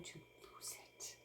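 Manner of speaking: slow, unvoiced whisper to herself; slow whispered confession. Real, not acted. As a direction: breathe it out, barely voiced. Stressed, muttering about losing it.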